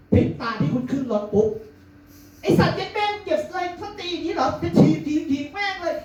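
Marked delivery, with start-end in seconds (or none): 2.0-5.9 much higher furious cursing; mother's road rage. Retold or imitated. Mother at the wheel swears at a bad driver, much higher raging. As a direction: curse the car ahead in a shrieking rage.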